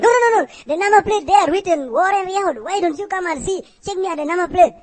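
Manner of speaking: exasperated yelling at a lost worker. Acted. Boss shouting at worker over phone.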